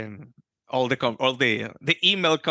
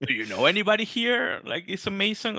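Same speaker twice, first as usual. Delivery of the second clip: higher, eager wife voice; wife's voice; 7 semitones higher than usual. Retold or imitated. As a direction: quote the excited wife. Wife voiced in retold story.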